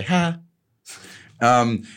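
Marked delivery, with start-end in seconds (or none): 0.0-0.4 high mock Italian "Ha"; mock Italian greeting. Telling his brother how they greet, a high mock Italian "Ha". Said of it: "we just say".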